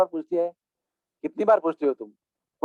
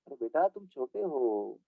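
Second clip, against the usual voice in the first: coaxing elder; 5 semitones lower, 10 dB quieter, slower than usual. Retold or imitated. Elders coaxing the stubborn child.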